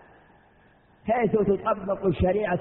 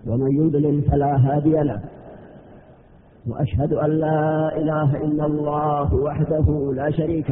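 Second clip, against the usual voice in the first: formal sermon opening; 4 semitones lower than usual. Acted. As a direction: intone the opening praise solemnly. (افتتاح خطبة بصوت جهوري رسمي)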